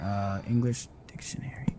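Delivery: soft unvoiced whisper; hushed whisper. Real, not acted. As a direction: breathe the words without voice. Whispering while reading.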